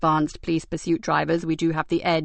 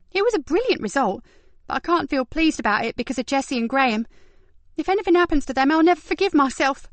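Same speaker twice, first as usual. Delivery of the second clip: put-on lightly higher, anxious young woman; a touch of worry, higher voice; worried Kat; 7 semitones higher than usual. A narrator's reading.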